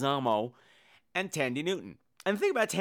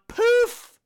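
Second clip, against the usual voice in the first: poof sound; 8 dB louder than usual. Acted. Sound effect of vanishing.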